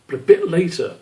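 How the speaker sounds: plain reading voice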